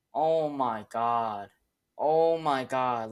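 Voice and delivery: long, drawn-out groan of dismay; slow, weary disbelief; despairing. Real, not acted. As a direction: stretch each word out in despair. Despairing over his team's trade.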